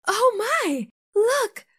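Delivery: high, fast excited exclamation; high-pitched astonished cry; amazed townsperson. Acted. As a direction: gasp it out in wonder. Townsperson amazed at drowning rats.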